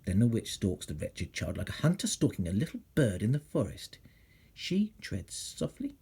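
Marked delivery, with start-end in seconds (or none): 4.5-6.0 soft and slow, creeping; hushed. A narrator's reading. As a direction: tiptoe through the words. Stalking scene, hushed telling.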